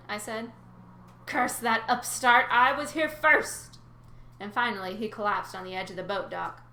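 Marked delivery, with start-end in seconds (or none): 1.2-3.8 slow, loud bellowing curse; loud slow indignant rant; furious old god. Acted. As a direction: bellow it like a sore loser. Nereus rants at rival.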